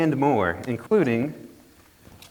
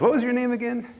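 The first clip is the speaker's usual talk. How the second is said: much higher, nervous squeak of a question; nervous disbelief; 13 semitones higher than usual. Acted. Jack to the devil, squeaking with nervous disbelief. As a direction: stall him, voice jumping way up.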